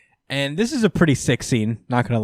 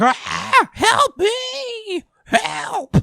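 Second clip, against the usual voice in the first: high, frantic crying shout of "help"; panicked scream for help; much slower than usual. Retold or imitated. Mocking the beaten warden as he screams for help. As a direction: shriek for help like a terrified coward. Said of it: "the warden starts screaming for it".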